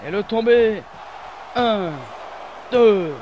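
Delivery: loud pinfall count; shouting the count. Real, not acted.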